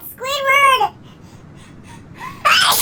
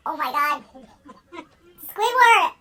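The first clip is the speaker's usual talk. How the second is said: usual speaking voice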